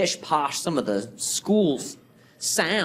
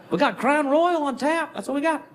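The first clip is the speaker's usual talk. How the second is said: put-on Southern accent; 9 semitones higher than usual. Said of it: "the clerk answering".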